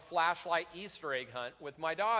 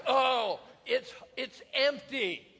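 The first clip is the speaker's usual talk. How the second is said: high, loud "oh", then a slow letdown; mock disappointment; 6 semitones higher, 4 dB louder, slower than usual. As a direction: imitate let-down kids, pitched up and drawn out. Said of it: "they're always disappointed".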